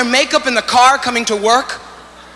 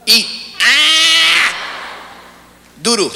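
put-on higher babyish eat and doo-doo; 5 semitones higher, much slower than usual. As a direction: talk like a baby with two choices. Baby's two decisions.

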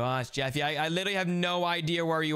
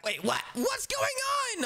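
high, drawn-out startled wail; hammed-up bewildered waking cry; startled awake; slower than usual. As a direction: cry out high as if jolted from sleep. Acts being woken at night.

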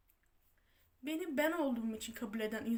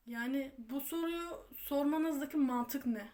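ordinary delivery

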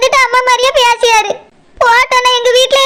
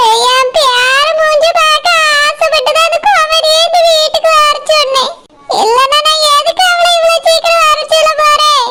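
tearful wail; 4 semitones higher than usual. Acted. Grandmother to son about seeing her grandson, wailing. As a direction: plead in a weeping singsong. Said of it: "நீங்க அழாதங்கம்மா".

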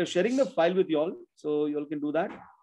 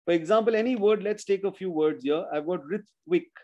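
usual speaking voice

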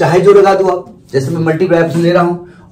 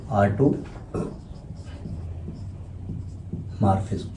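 unvoiced, slow whisper of automorphism; whispered dictation; 8 semitones lower, 15 dB quieter, much slower than usual. Shown as a demonstration. Teacher whispering the term during dictation. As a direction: breathe the term out slowly. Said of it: "dictating term".

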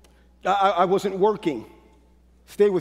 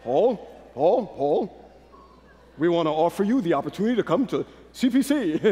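imitating Elder Wright; 6 semitones lower than usual. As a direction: lightly voice the elder calling Paul. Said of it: "you know Elder Wright".

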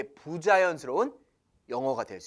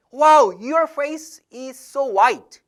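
gushing compliment; 6 semitones higher, 7 dB louder, much slower than usual. Shown as a demonstration. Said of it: "이런 거 안 됩니다".

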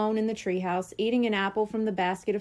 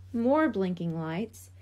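ordinary delivery

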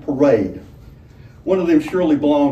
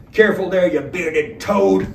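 admonition; 4 semitones higher, 4 dB louder than usual. A narrator's reading. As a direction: drag out the insult as a warning. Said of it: "was the admonition".

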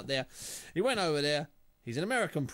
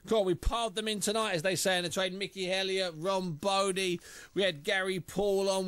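ordinary talk

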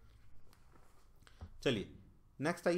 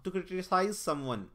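slow reading aloud; 3 semitones higher, much slower than usual. Shown as a demonstration. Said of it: "reading an option".

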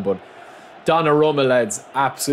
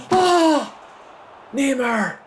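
very high-pitched cry at a near miss; agonised scream; much slower than usual. Real, not acted. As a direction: scream the player's name in disbelief. Chance just missed.

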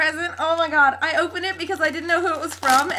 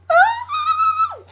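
very high, loud squeal; piercing fangirl "oh"; excited squeal; 8 dB louder than usual. Real, not acted. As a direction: squeal uncontrollably with delight.

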